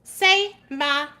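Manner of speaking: drawn-out mocking challenge; sassy challenge. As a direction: say my name like a dare. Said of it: "and I thought".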